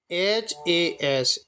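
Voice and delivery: letters spelled out slowly one by one; slow spelling. Shown as a demonstration. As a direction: spell each letter clearly for the class. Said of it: "teacher spells letters".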